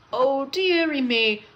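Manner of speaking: lament, building on the repeat; storybook moan of dismay; groaning. A narrator's reading. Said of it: "groaned Thomas".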